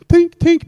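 high, sharp "tink"; dart sound effect. Retold or imitated. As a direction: imitate small darts landing, high and loud. Imitates darts hitting a board.

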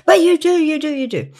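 put-on high, loud childish protest that fades; shrill insistence. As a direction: whine it back like a hurt child. Client insisting, voice retold.

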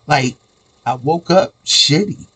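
normal talking voice

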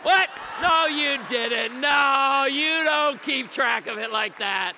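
loud amazed disbelief; astonished. Real, not acted. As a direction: shout it in happy disbelief. Amazed at exact marriage count.

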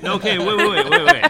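high, loud laughing wait; excited laughing yell. Real, not acted. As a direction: cut in, shrieking with laughter. Stopping friend mid-theory, laughing.

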